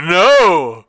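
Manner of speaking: loud defiant no; shouted no. Retold or imitated. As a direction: yell it out in defiance. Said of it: "he's like".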